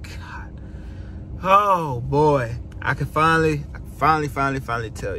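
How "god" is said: breathed whisper; just air on the word; soft awed whisper. Real, not acted. Whispered aside.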